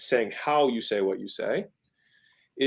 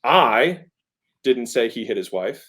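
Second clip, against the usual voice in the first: "I" loud and set apart by a pause; heavy emphasis on the first word "I"; stress on I; 4 dB louder, slower than usual. Shown as a demonstration. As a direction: punch "I", then pause before the rest. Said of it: "The emphasis is".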